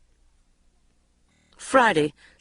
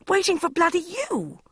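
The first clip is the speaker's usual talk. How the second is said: through clenched teeth; 12 semitones higher than usual. A narrator's reading. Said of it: "between clenched teeth".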